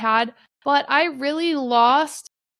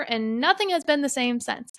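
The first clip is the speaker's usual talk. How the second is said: normal talking voice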